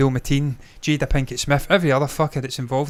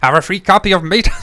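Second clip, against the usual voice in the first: loud, higher mock Bristol pirate; badly faked Bristol pirate voice; Bristol accent; 4 semitones higher, 6 dB louder than usual. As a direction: attempt a hammed-up Bristol accent. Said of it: "I can't do a Bristol accent".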